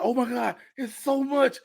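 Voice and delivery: high, mildly giddy gush; a touch of losing it, high-pitched; overwhelmed excitement. Retold or imitated. Comedian reliving the food choices, high overwhelmed gush. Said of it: "I was losing it".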